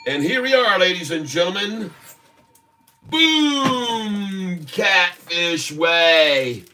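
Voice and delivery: high, drawn-out reveal; showman announcer. Acted. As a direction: announce it like a game show host. Grand product reveal.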